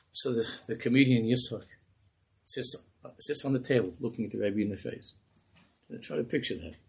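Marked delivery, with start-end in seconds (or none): none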